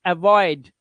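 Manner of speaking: अवॉइड with a clear 'oy' in it; 'oy' sound. Shown as a demonstration. Teacher giving an example word, 'oy' sound. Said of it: "ओई की आवाज देनी है".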